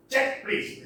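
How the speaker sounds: "Check, please" with a beat on each word, pitched high; both words stressed. Shown as a demonstration. Lecturer showing that both are stressed, both words stressed. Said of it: "Both are stressed".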